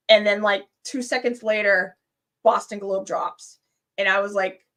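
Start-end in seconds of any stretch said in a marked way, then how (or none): none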